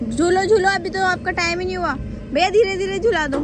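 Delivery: high loud calls over the ride; calling out. Real, not acted. (झूले वाले को ज़ोर से कहना)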